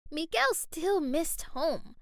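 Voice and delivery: ordinary delivery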